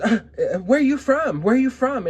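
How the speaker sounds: high, loud mocking of the rude lady. To the narrator at the store, a loud, high mock of the lady. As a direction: imitate the lady demanding where she is from, loud and high.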